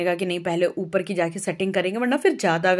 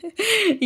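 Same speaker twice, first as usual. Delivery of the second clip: short, higher-pitched laugh; real laugh; 12 semitones higher than usual. Real, not acted. Vlogger joking to viewers about her critics, laughing. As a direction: laugh at your own remark.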